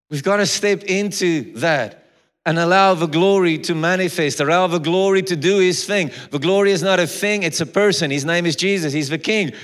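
preaching at full force. Acted. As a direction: proclaim the verse with rising force.

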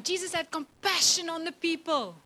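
ordinary talk, nothing marked

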